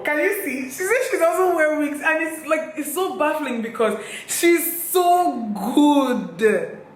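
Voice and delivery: high excited gush; amazed. Real, not acted. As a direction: marvel at her skill. Amazed about the stylist.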